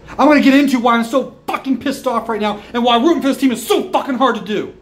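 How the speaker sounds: ordinary delivery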